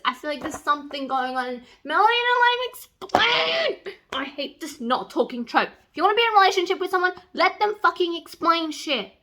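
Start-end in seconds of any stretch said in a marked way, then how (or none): 1.8-4.0 slow, high exasperated whine; indignant whine. Real, not acted. Ranting about the trope. 5.7-9.1 rapid, higher exasperated rant; fast rant. Real, not acted. Ranting about the trope.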